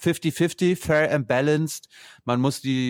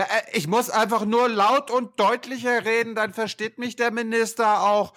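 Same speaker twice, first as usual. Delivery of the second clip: mock high voice; 10 semitones higher than usual. Mocking loud clear speaking.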